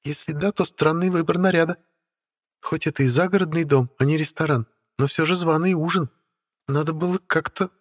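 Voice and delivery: high, sweet, catty little voice; cartoon princess. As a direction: needle her sweetly. Witch Stella speaking. (сладко-ехидная принцесса)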